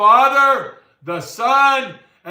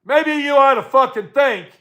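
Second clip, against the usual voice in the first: higher and louder, scolding; angry wake-up call; 4 semitones higher, 4 dB louder than usual. Real, not acted. Telling viewers like his friend to wake up, raised voice. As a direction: shout them awake.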